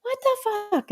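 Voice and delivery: high, drawn-out squeaky bird; very high cartoon bird voice; little bird voice. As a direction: stretch it out as a baffled little bird. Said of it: "it's going".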